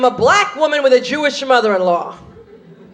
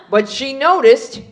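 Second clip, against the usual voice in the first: ordinary talk, nothing marked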